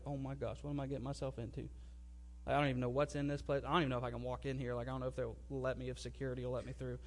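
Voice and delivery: low, hushed talking to oneself; retold anxious inner monologue, kept small and quiet; muttered worry. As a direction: mutter uneasy doubts low and quick, under your breath. Inner worry on arriving at church.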